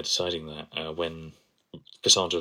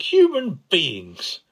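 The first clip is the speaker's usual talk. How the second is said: much higher, louder, very slow marvelling; imitating Ten in awe; 11 semitones higher, 7 dB louder, much slower than usual. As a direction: stretch it out in wide-eyed wonder. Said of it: "just being like".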